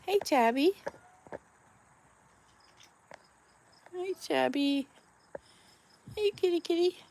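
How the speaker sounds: high-pitched voice for talking to cats; greeting a pet. Real, not acted. Owner saying hello to Tabby the cat, high pet voice.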